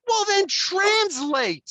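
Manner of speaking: slow, high, loud snapping demand; angry father at the daughter; retold yell. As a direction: bark it slowly at her. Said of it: "I'm like".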